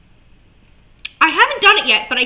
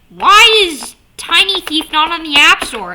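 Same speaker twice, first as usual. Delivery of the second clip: incredulous; 5 semitones higher, 9 dB louder than usual. Retold or imitated. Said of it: "And I'm like".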